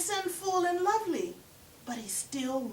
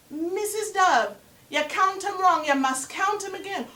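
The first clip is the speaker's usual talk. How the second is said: louder and higher, scolding trickster; pushy spider, raised voice and pitch; 1 insistent Anansi; 6 semitones higher, 6 dB louder than usual. Acted. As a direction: push her to count again.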